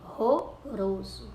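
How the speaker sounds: "Ronroroso" slowly, syllable by syllable; strong R demonstration. Shown as a demonstration. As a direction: stretch "Ronroroso", strong breathed first Rs, tapped last R. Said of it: "eles têm o som do R forte". ("Ronroroso" devagar, com os dois primeiros R fortes)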